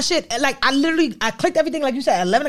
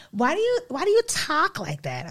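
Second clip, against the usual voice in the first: higher, softer Maine voice, TALK as "tahk"; imitated Maine girl's accent; 7 semitones higher, 5 dB quieter than usual. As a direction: mimic a Maine accent, questioning. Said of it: "she used to be like".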